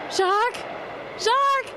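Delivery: panicked calls into the blizzard; crying for help. Acted. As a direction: scream for help, close to tears. Lost, calling for help.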